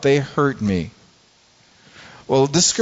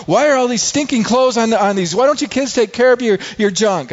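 grumbling rant; 9 semitones higher than usual. Retold or imitated. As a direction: rant like a fed-up dad tripping on clothes. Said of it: "mumbling and grumbling".

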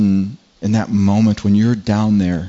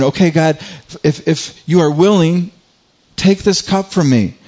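high-pitched, pleading Jesus in anguish; overwhelmed plea; 6 semitones higher than usual. Retold or imitated. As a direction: beg God as if overwhelmed, voice raised high. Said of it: "He's, he's overwhelmed".

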